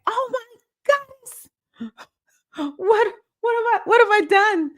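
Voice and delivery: high, wailing mock distress; mock panic. Retelling her own panic at a big responsibility, mock wail. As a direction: play the overwhelmed newcomer, half crying. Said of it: "And then you get in like".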